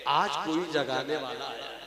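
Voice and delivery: soft, restrained statement; hushed. Acted. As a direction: say it low in volume, letting it sink in. Same line softly, then cried out. (धीमी, दबी आवाज़ में)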